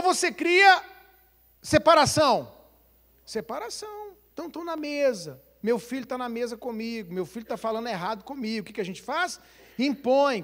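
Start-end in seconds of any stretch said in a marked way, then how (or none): none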